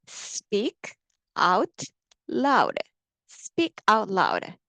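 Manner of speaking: slow, drawn-out model phrase; teacher urging students to speak up; speak out loud. Shown as a demonstration. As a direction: spell the phrase out word by word. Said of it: "크게 하셔야 됩니다".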